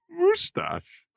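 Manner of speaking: high, drawn-out posh moustache; mock a posh Downton Abbey accent; mocking upper-class moustache; posh imitation. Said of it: "Downton Abbey".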